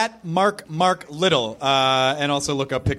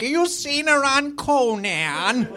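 high slow lightly voiced grandma; grandmother voice; 9 semitones higher, slower than usual. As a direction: ask it like a sweet old grandma. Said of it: "like a grandmother".

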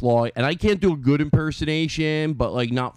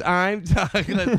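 Todd Glass catchphrase; 4 semitones higher, much slower than usual. Retold or imitated. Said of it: "It's just like this".

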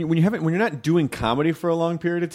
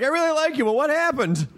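mock pleading; 13 semitones higher than usual. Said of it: "Jonah's gonna be like".

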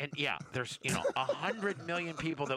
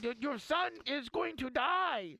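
mock panicked wife; 10 semitones higher than usual. Friend mimics the wife panicking about the seatbelt, high and hammed-up. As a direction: pitch it way up like the frantic wife.

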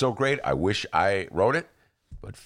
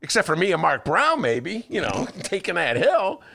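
excited; 14 semitones higher, 4 dB louder than usual. Pretend. Joking about running the hill.

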